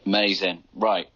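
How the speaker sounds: ordinary talk, nothing marked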